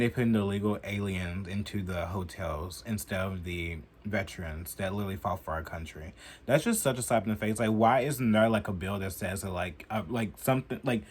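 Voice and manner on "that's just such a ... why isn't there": ordinary talk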